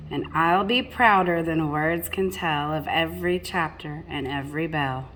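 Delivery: plain reading voice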